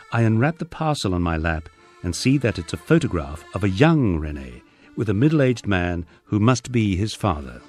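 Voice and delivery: usual speaking voice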